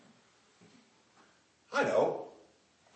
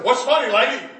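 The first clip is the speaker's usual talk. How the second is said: loud, high, offended what's funny; indignant loud question; 10 semitones higher, 10 dB louder than usual. Retold or imitated. Ex-addict to the laughing lady, loud and offended. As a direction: demand to know what's so funny. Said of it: "He said".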